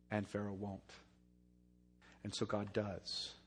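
soft, grave preaching, drawn low; hushed sermon. Acted. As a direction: pull them in with a hush. Preacher drops to hushed intensity.

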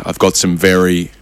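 low, slow grave announcement; mock-solemn official, measured and low; Dan Andrews. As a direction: deliver tragic news at a press conference. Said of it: "When you got Dan Andrews".

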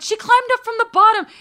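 astonished old-lady impression, high and loud; imitated little lady spreading the news; high-pitched little voice. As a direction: announce it in a high, amazed little voice. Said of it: "she has this high-pitched little voice".

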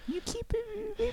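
very high, slow squeaky voice; Adam Sandler impression. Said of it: "That's Adam Sandler".

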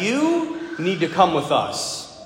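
low, slow voice of dark figures; ominous summons. Retold or imitated. Dark silhouettes summoning a man in the hospital, low ominous retelling. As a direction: lightly voice a sinister, measured command.

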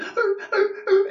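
high yelping dog noises through a laugh; imitated dog whining. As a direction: whine like the excited dogs, laughing. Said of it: "they were like".

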